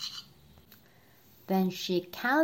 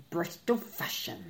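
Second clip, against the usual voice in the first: lightly voiced low admiral; a hint of an old sea captain; admiral's voice; 4 semitones lower, 4 dB quieter than usual. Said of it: "said the admiral".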